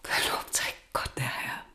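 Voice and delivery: relieved, breathless whisper of thanks; soft grateful whisper to God; whispered prayer. Acted. Relieved prayer as patient wakes.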